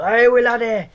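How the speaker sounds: high, loud attempt at Scottish; bad Scottish accent. Shown as a demonstration. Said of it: "can't fucking do a Scottish one".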